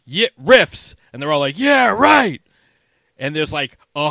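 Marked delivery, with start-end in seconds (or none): 0.0-0.9 shouted high and loud rally cry; yelled catchphrase. Retold or imitated. Gang leader rallying his men, loud shout. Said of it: "yell his catchphrase". 1.6-2.4 mock cheer, high and loud; crowd cheer. Gang roaring back at their leader, loud cheer.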